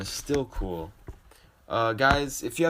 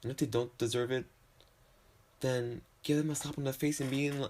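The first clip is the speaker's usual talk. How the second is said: ordinary talk, nothing marked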